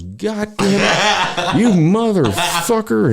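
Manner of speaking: high, drawn-out mock outrage; startled old man cursing. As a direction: imitate an old man's slow, high-pitched cursing. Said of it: "he turns around, and he goes".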